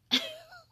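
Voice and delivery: short very high laugh; a quick squeal of a laugh. Real, not acted. Laughing at the goofy claims, short high laugh.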